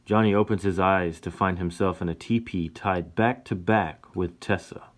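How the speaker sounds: ordinary delivery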